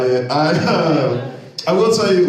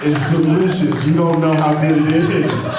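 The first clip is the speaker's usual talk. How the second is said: higher, louder, savoring delight; gleeful gloating; 7 semitones higher, 4 dB louder than usual. Acted. Comic gloating about watching the girlfriends argue. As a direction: relish every word of it.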